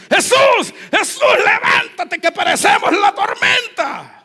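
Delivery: high, loud, desperate shout; panicked shouting. Acted. Disciples running to wake Jesus in the storm, terrified shout. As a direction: scream for help in terror. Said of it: "Los tipos fueron corriendo".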